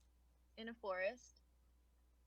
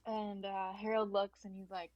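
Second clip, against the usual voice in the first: ordinary talk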